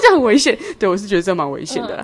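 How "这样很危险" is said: loud, high stern warning; scolding. Retold or imitated. Bus driver warning the passenger, loud and stern. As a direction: warn sharply that it's dangerous.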